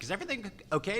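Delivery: high, soft little question; concerned manager. Retold or imitated. Manager stepping out of the shop, soft high query. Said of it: "the manager comes out and goes".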